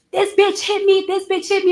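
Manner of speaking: high, frantic mock accusation; mimicking a wild accusation. Witness imitating the receptionist yelling accusations, high frantic mimic. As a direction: act out the receptionist losing it, pitch way up. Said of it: "wilding out".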